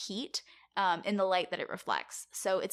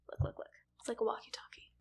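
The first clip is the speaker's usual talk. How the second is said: soft hushed whisper; 2 semitones higher, 7 dB quieter, faster than usual. Real, not acted. Host whispering to cohost while showing a photo. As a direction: whisper it while pointing at the picture.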